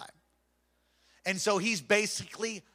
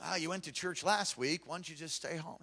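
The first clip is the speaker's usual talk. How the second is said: sly hushed tempting; 2 semitones lower, 7 dB quieter than usual. Acted. Devil tempts, churchgoer gives in.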